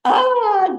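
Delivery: high piercing shriek of shock; mother's sharp scream. A narrator's reading. Mother sees her missing son and screams. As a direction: scream it out, shocked to see him. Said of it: "Junmei screamed".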